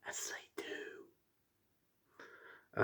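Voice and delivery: unvoiced dry whisper; a whispered sarcastic aside. Real, not acted. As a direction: mutter it under your breath. Dry aside after complaint.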